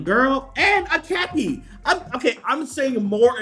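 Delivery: high, slow, drawn-out delight; high drawn-out gush; excited squeal. Real, not acted. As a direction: stretch it out, thrilled. Spotting details, excited.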